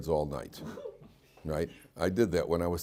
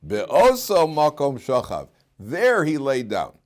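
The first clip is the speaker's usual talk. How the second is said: Be'oso and There pushed up high and loud; emphatic place words; 5 semitones higher, 7 dB louder, slower than usual. Shown as a demonstration. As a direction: slowly lean on "Be'oso" and "There". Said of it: "every single inflection".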